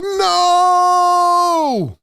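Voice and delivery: anguished no. Retold or imitated. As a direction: cry out as the ball is lost. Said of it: "I'm like".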